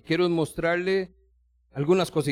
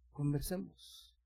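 much softer, slow, inviting Conversemos; soft slow invitation; 12 dB quieter, slower than usual. Acted. God inviting the worried believer to talk, hushed. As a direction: draw out Conversemos gently, almost hushed.

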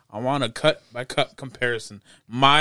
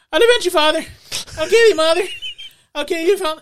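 high, loud imitation of a boy; kid from the trailer; 10 dB louder, faster than usual. Friend mimicking the trailer's kid, high loud kid impression. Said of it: "that's how the kid sounds like".

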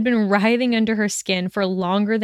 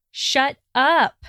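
higher, mildly raised angry cry; shouted; 6 semitones higher than usual. A narrator's reading. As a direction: cut him off with a contained shout. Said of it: "Hermione shouted".

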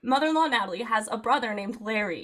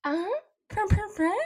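high purr imitation; lightly imitated cooing purr; soft purring voice; 7 semitones higher than usual. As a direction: purr it like a cat. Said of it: "a soft voice go".

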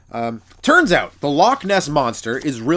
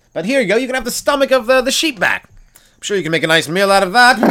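mock English conqueror; 7 semitones higher, 5 dB louder than usual. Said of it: "that's what the English did".

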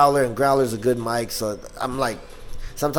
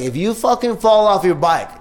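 higher-pitched mock warning; retold parental threat; 7 semitones higher, 3 dB louder than usual. Mexican parent warning a kid about the bike, mock threatening. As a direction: imitate a parent laying down a threat. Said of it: "threaten me".

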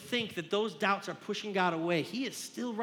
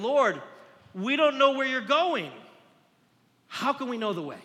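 pleading Thomas; 6 semitones higher, 6 dB louder than usual. Acted. Thomas questioning Jesus.

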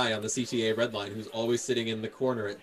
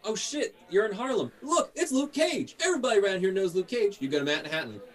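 much higher, starstruck street voice; excited New York passerby; 8 semitones higher, 3 dB louder than usual. Pretend. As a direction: gawk at a local hero, pitched way up. Voicing excited Harlem locals.

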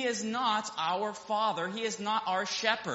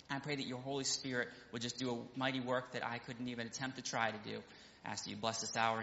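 soft, low, hushed pulpit prayer; softer, reverent prayer voice; 10 semitones lower, 8 dB quieter than usual. Real, not acted. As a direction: pray quietly and humbly before the sermon. Pastor leads opening prayer.

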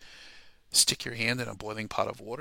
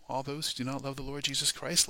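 usual speaking voice

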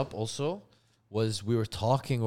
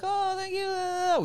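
much higher, slow sugary thank-you; sweet mom thanks; much slower than usual. Retold or imitated. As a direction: mom gushing over the cake.

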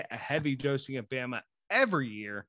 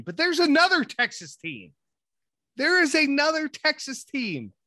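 higher and louder, building the big reveal; hyped reveal; 10 semitones higher, 5 dB louder than usual. Real, not acted. Co-host teasing his partner with a hyped reveal. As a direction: sell the surprise pick. Hyping up a surprise draft pick.

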